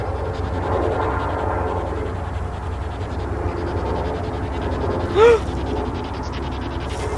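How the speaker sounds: sharp startled gasp; frightened gasp. Real, not acted. Lights flash in the dark.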